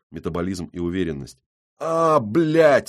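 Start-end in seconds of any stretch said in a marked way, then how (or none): 1.8-2.9 groan. Acted. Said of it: "стонет гопник".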